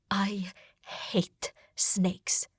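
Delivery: slow, breathless whisper; shaken whisper. Acted. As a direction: whisper it, still trembling. Shaking after snake attack.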